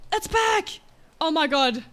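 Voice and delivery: high shriek of relief; shrieking jackpot winner. Real, not acted. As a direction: gasp then shriek with joy. Item reappears, shrieking joy.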